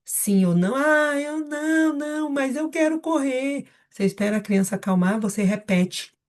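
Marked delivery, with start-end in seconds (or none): 0.7-3.7 put-on high, drawn-out child's protest; whining child. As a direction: whine slowly like a protesting kid. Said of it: "Se a criança responder que é isso". (voz aguda de criança manhosa)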